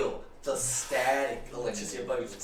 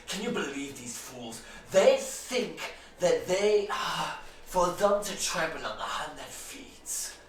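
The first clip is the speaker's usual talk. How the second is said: lightly voiced haughty elf officer; young elf lieutenant; 4 semitones higher, 6 dB louder than usual. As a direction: speak as a smug young elf.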